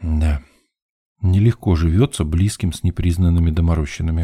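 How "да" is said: ordinary delivery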